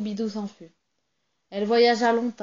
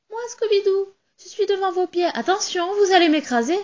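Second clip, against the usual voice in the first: much higher tiny doll voice; little doll voice; 10 semitones higher, 3 dB louder than usual. Acted. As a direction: speak up small and high from underfoot. Tiny doll at sailor's feet.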